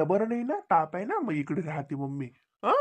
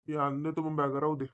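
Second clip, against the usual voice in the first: normal talking voice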